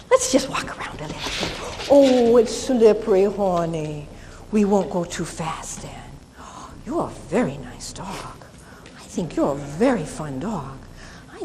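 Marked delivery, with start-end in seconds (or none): 1.9-8.4 hushed, soothing whisper. Shown as a demonstration. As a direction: whisper to calm the dog. Soothing dog on slippery floor.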